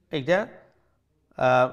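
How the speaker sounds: normal talking voice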